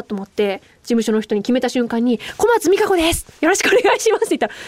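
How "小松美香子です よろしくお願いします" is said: eager self-introduction. Retold or imitated. Retold first introduction to agency staff.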